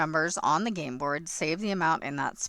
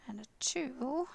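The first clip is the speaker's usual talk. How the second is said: whispering; 6 semitones higher, 6 dB quieter than usual. Real, not acted. Counting under breath.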